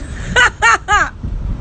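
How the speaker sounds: bursts of high laughter; high cackling laugh. Real, not acted. Vlogger amused at her one piece, high cackling laugh. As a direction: cackle in quick high pulses.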